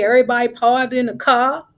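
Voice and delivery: usual speaking voice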